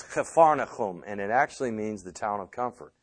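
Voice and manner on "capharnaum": Capharnaum said kha-far-na-KHOOM; throaty kh sounds in Capharnaum; Hebrew pronunciation. Shown as a demonstration. Bible teacher explaining the town's name, Hebrew pronunciation. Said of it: "In, uh, Hebrew, that's".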